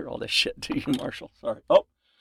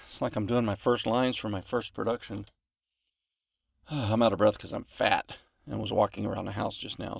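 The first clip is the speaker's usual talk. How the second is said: usual speaking voice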